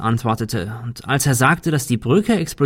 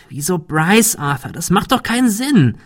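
höherer, scharfer Einwand; 8 semitones higher than usual. A narrator's reading. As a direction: widersprich scharf, fast als Kritik. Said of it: "Cecil sprach scharf". (scharf und kritisch)

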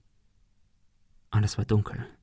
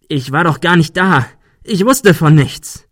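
panicked defense; 6 semitones higher, 6 dB louder than usual. A narrator's reading. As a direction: plead innocence desperately. Ben defends himself in panic. (panische Verteidigung)

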